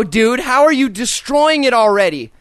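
ordinary talk, nothing marked

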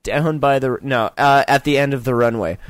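ordinary talk

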